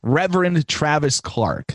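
slow, reading the full title. Real, not acted. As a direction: read the title out slowly. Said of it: "what is his name".

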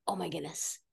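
a hushed sigh of relief from a new parent; relieved hush. Retold or imitated. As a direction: breathe it out in quiet relief. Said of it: "where you're like".

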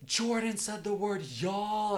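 high, drawn-out teasing, loud; mocking sister. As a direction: imitate a sibling's singsong tattling. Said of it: "she just, like, was poking fun at me".

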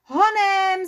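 rally the troops; commanding call. Acted. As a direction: leader ordering a rush. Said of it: "zavelel Barvička".

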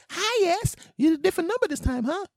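fast, loud, high falsetto; restaurant order taker. Acted. Worker recognizing caller on different number, falsetto. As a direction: chirp in a squeaky phone voice.

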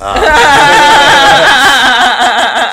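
shrieking laugh, high and loud; real laughing scream; loud high shriek of laughter. Real, not acted. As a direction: cackle loudly at the joke. Big laugh before the story.

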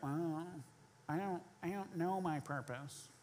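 mock-whine like a sulky kid; imitating churchgoers whining about their purpose; whiny. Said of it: "I don't know why you say it like that. You're always whiny".

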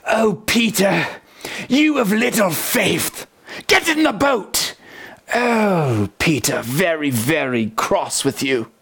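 lightly British old-film Jesus, mock cross; a hint of a stern British screen Jesus scolding Peter. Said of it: "like, British".

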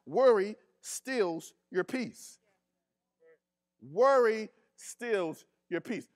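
drawn out, weighing each word; slow emphatic preaching. Acted. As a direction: let the line sink in slowly. Slow repeated preaching line.

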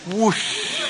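put-on breathy wind whoosh for the kids; whoosh sound. Shown as a demonstration. Showing the wind blowers their whoosh, a soft wind sound. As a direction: blow it out like the wind. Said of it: "maybe we could say whoosh".

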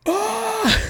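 very high sharp scream of pain; pained yelp. Retold or imitated. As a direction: yelp as the scab rips. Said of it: "And I'm like".

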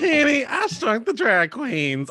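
high, slow drag queen drawl; Lady Bunny voice. Retold or imitated. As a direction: camp drag queen impression. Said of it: "like, Lady Bunny voice".

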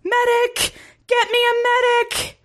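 very high, strained cry for help; shouted. A narrator's reading. Stricken commander calling for help, shouted. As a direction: call out high and drawn out. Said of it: "the commander shouted".

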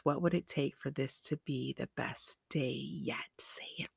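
whispering the mantra; hushed mantra; whispered affirmation. Shown as a demonstration. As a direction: whisper it like a private promise. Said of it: "whispered affirmation demo".

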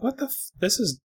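high, incredulous retold rant; mock outrage. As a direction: squeak out the disbelief then grumble. Retold shock at eBay bill.

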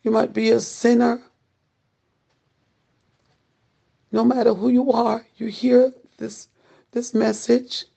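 urgent pleading preacher, pressing hard; doomsday street preacher. Acted. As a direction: plead with them to repent now.

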